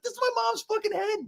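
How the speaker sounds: very high, hammed-up child's wail; traumatized kid. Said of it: "any kid would have been traumatized".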